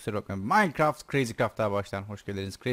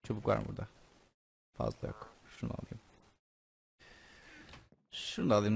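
usual speaking voice

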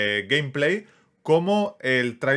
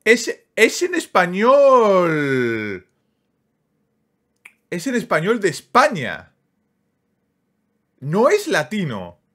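slow, higher and louder, savouring each word; excited emphasis; 7 semitones higher, 6 dB louder, slower than usual. Real, not acted. Streamer tells viewers the dub is Spanish, excited. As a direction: stretch it out, delighted it's in Spain Spanish.

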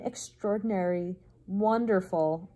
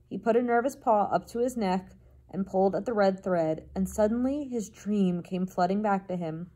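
ordinary delivery, nothing marked

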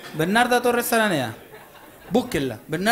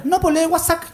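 mother's voice; 8 semitones higher than usual. Retold or imitated. Son imitates his mother on the phone in a high insistent voice. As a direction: play the stubborn mother, pitched way up.

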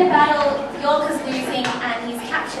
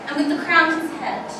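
proud, scornful queen mocking a captured enemy; Queen Margaret taunting York. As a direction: declaim it like a vengeful queen gloating over her prisoner. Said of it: "I'm actually playing the role of Queen Margaret".

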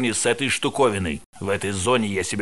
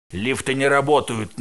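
ordinary talk, nothing marked

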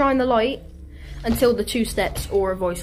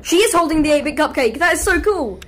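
thrilled, higher-pitched rush; real excitement; 5 semitones higher, 5 dB louder than usual. Real, not acted. Gamer spots the eight-bit cupcake, excited. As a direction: gush loudly with fan delight.

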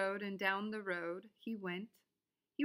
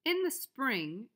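ordinary delivery

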